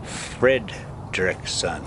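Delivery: ordinary delivery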